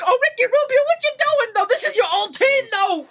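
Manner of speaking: very high, squealed teasing; hyped taunting. Real, not acted. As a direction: heckle the player in a high, excited squeal. Teasing the beaten player.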